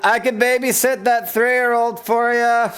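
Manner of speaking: high, slow, drawn-out call; calling after someone. Acted. Offering to babysit as he leaves, calling after him. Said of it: "me calling after him".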